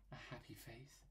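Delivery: soft secret whisper. Acted. Telling viewers everyone loves happy faces, whispered. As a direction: breathe it like a confidence.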